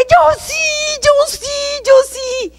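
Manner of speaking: very high repeated cry, reenacted; desperate yelled insistence. Sinner answering the voice, desperate high cry. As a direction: cry it out insistently, pitched far up. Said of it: "Y yo grité".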